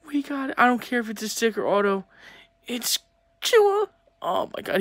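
high-pitched, giddy elation; real squealing excitement; overjoyed disbelief. Real, not acted. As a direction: let the voice leap up on the name. Overjoyed after pulling favorite card.

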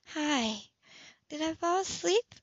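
lightly voiced drowsy woman; sleepy woman. A narrator's reading. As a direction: lift the voice, just woken. Woman wakes, voiced higher.

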